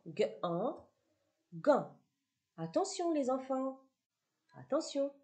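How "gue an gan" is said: hard "gue", then nasal "an", then blended into "Gan"; teacher blending the sounds into "Gan"; sounding out syllables. Shown as a demonstration. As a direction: say the two sounds apart, then join them as "Gan". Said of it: "on va passer à la combinatoire".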